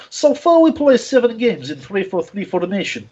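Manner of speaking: jokey put-on Russian accent, reading a fan's comment aloud; faux Russian accent. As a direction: ham up a fake Russian accent while reading. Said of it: "read this in a sort of faux Russian accent".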